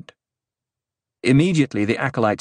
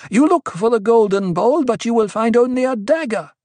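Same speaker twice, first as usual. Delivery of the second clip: high, loud warning; fierce rebuke; 12 semitones higher, 5 dB louder than usual. A narrator's reading. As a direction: denounce him before the crowd. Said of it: "She's in a fierce mood tonight".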